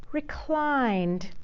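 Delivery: lazy, elegant murmur; languid recline. A narrator's reading. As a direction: stretch the word out luxuriously. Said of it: "She didn't lie down".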